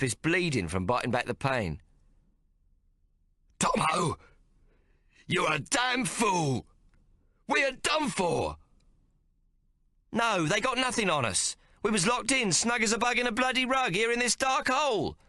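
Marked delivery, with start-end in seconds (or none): 3.6-8.6 high, slow furious shouting; Hawk shouts. A narrator's reading. Hawk berating his brother, slow angry shout. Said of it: "he must often rest as he shouts". 10.1-15.2 high insistent protest. A narrator's reading. Boy protesting to his brother, high insistent. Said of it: "I protest".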